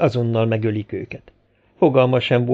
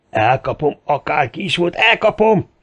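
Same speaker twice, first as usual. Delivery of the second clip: enraged shout; 5 semitones higher than usual. A narrator's reading. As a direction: build up to a furious cry. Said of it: "ordította Volodya". (dühösen ordítva)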